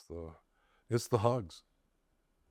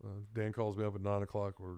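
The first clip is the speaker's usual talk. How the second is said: ordinary delivery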